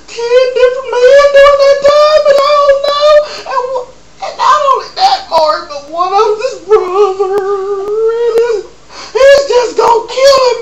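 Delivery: high, loud hammed-up sobbing confession; mock sobbing. Guest sobbing to Maury about her boyfriend. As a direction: blubber it out in a wail.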